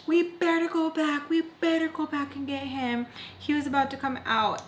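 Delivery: high-pitched whiny pleading. Retold or imitated. Friend begging the driver to go back for him, pleading.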